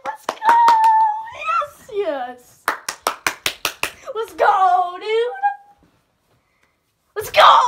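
real screaming celebration. Real, not acted. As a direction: scream with joy for the win. Celebrating championship win.

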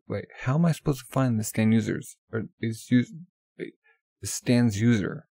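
normal talking voice

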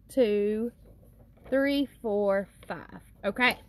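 ordinary talk

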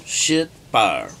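usual speaking voice

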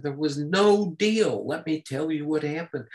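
high-pitched, insistent retold rebuttal; retold emphatic denial. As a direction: push back firmly, as if thumping the table. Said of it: "slammed his fist down".

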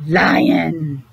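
ordinary talk, nothing marked

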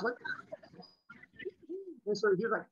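ordinary talk, nothing marked

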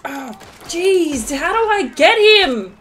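high, loud exasperated cry; frustrated outburst. Real, not acted. Player struggling to hit an enemy, frustrated outburst. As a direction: raise the pitch and volume in exasperation. Frustrated reaction during gunfight.

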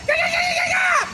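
very high squealing yeahs; high excited yeahs. Real, not acted. Fan squealing over the win. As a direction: shriek the yeahs with joy.

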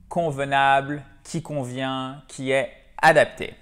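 ordinary talk, nothing marked

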